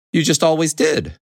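bright high little hen; chirpy high-pitched hen greeting. Acted. Hen to the horse.

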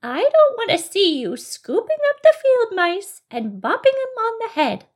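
high, emphatic fairy scolding. Acted. Fairy scolding a bunny for bopping mice, high fairy-like voice. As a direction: scold as a loud little storybook fairy. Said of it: "she said in a fairy-like voice".

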